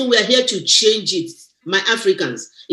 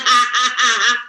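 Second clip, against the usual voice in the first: imitated laugh; 5 semitones higher than usual. Teasing a friend about how she used to laugh, mock laughter. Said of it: "Did you see the way she's laughing".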